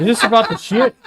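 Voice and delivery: loud, high question through laughter; real amused laughing delivery. Real, not acted. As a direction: crack up while asking. Joking reply amid shared laughter.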